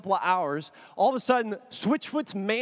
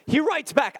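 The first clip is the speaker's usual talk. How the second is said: ordinary talk, nothing marked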